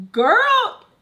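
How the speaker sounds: high, loud exclamation; shocked friend. Retold or imitated. Friends hear how she talks to mama, shocked. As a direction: call her out in mock shock. Friends react to her rude talk.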